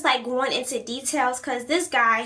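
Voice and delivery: ordinary talk, nothing marked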